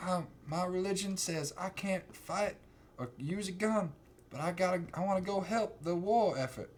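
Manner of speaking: high, softly voiced Southern boy; mock Southern country boy. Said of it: "he's, like, a Southern boy".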